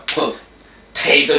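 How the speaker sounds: very slow "potato", split after the P syllable; stretched-out demonstration of "potato"; marked P. Shown as a demonstration. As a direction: say "potato" slowly as po... TAY-to. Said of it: "P-nya tuh potato".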